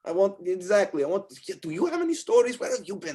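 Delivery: high, excited questioning; eager barmaid. Acted. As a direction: pepper travellers with questions in a high voice. Wendy eagerly asks adventurers for stories.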